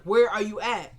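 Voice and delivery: loud, stressed retold demand; mad. Mad boyfriend cutting off her apology, loud and angry. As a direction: imitate a mad man insisting, punching the first word. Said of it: "Benny's mad as heck".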